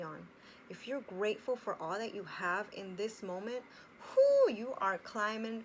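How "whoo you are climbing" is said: louder, slow, drawn-out whoo; excited whoo. Real, not acted. Encouraging viewers about gratitude, excited whoo. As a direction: cheer it up like rising.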